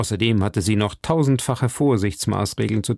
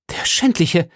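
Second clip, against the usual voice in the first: higher-pitched exclamation of outrage; indignant cry; 6 semitones higher than usual. A narrator's reading. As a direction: cry it out against a scoundrel. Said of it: "rief Milady". (empörter Ausruf)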